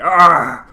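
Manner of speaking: loud mock roar; sudden loud creature cry; imitated dragon roar. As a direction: roar like a beast pouncing. Imitates dragon tackling them.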